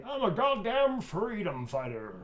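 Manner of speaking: high, loud, slow proclamation; defiant character quote. Acted. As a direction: proclaim it big, stretching the words. Performs the fan's requested quote again.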